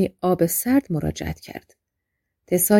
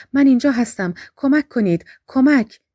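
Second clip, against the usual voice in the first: louder, higher cry out the window; shouting for help; 6 semitones higher, 6 dB louder than usual. A narrator's reading. Tessa screams for help from the window, panicked. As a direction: yell for rescue, rising to the last word. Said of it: "فریاد زد".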